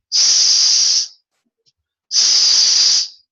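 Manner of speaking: S held as a long loud hiss. Shown as a demonstration. Teacher hissing a drawn-out S. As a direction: hiss the S out steadily. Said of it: "the letter S is turbulent".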